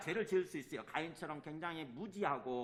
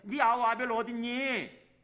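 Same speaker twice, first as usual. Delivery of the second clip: slow, loud, drawn-out question; preacher voicing God's searching question; God questioning Cain; 5 semitones higher, 7 dB louder, slower than usual. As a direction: call out to a wrongdoer, higher and louder. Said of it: "그래서 묻는 거예요".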